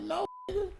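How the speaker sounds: very high, cracking mock scream; squealing outrage. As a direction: shriek it like your clean truck is being ruined. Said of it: "I'm like".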